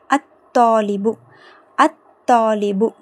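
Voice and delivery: held stop joining "அத் தாலிபு", no L; silent lam. Shown as a demonstration. Teacher shows lam unsounded, held stop in "அத் தாலிபு". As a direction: pause on the doubled consonant of "அத் தாலிபு". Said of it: "லாம் உச்சரிக்கப்படலை".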